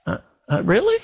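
high-pitched questioning mock huh; stammer, then jump up high on really; feigned incomprehension. Believer answering God's promise, feigned incomprehension. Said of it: "Or I can say".

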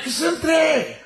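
put-on very high, tiny drunk voice; little voice. Drunk at the back joins the order, tiny high voice. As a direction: squeak it from the back of the bar. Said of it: "del fondo del bar una vocecita".

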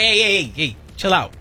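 ordinary delivery

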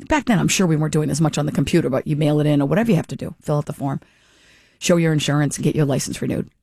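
usual speaking voice

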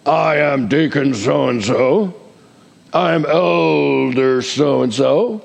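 slow, drawn-out pompous announcement; puffed-up church officer introducing himself; self-important title holder. Acted. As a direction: stretch the title with mock grandeur. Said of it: "for recognition".

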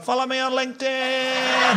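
high, slow, drawn-out cry; falling sleaze. Retold or imitated. Bore falling off the balcony, a high slow plea. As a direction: stretch it out like a man falling away. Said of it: "He's like".

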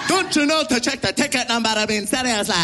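high, fast exasperated scold; mock an annoyed passenger telling him off; scolding stranger. Annoyed stranger scolding the comedian over seats.

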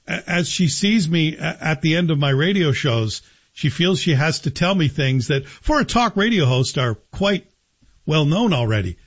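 ordinary talk

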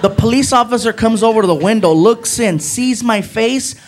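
ordinary talk

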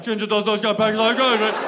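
loud, fast, garbled mock British; British crowd jeering. Whole store mocking the customer, loud mock British. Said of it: "And the whole store is like".

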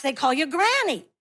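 ordinary delivery

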